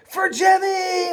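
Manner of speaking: high, slow, loud rallying shout of "For Jimmy!"; battle cry. Pretend. Players charging Captain Hook to avenge the spider, battle cry. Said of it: "the catchphrase of the day".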